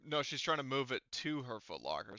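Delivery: usual speaking voice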